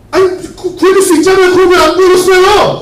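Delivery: high, loud, aggrieved demand; indignant protest. Acted. Drowned man confronts God about the unanswered prayer, indignant. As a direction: complain as if cheated.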